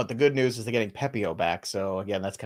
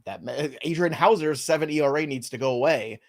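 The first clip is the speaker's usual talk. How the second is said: normal talking voice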